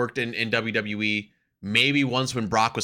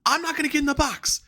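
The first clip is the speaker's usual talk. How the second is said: much higher, whiny refusal; mock Ethan Page whine; 14 semitones higher than usual. Mocking Ethan Page refusing to enter the box, high whine. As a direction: play a petulant wrestler refusing the penalty box. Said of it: "And doing the whole".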